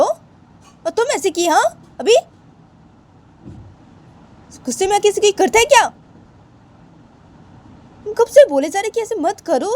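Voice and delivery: high-pitched, indignant scolding with insistent questions; outraged scolding uncle. Acted. (ऊँची आवाज़ में गुस्से भरी डाँट)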